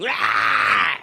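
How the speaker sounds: short barked roar; mock Palpatine roar. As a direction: growl it like an evil emperor zapping. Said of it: "Emperor Palpatine was like".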